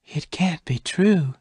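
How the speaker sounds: slow, stunned disbelief; slowly. A narrator's reading. Bess learning her real name, slow and stunned. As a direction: let each word drag in shock. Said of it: "Bess said slowly".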